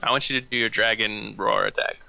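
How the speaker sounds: ordinary delivery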